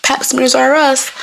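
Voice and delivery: high, slow, put-on caller voice; code-switched phone voice. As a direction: put on a slow, polite doctor's-office voice. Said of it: "I code switch when I'm calling the doctor".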